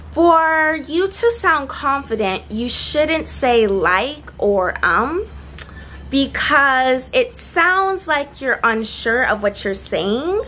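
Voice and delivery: unsure rising tune on every phrase, slow; teacher demos uptalk, making a statement sound like a question; ending like a question. Shown as a demonstration. Teacher shows tip one spoken as a hesitant question. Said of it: "let's say that I ended it as if it's a question".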